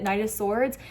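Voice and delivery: usual speaking voice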